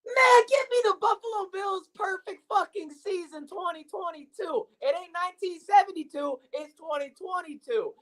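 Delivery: high hyped yelling; excited shout. Real, not acted. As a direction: hype it up like a fan.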